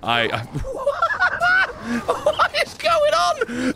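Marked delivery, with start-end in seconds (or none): 0.5-2.0 squealing high laugh; helpless high laugh at the screen; high burst of laughter. Real, not acted. Cracks up at game chaos. 2.1-3.7 high, slow words through laughter; slowly laughing it out, high-pitched. Real, not acted. Laughing at game chaos.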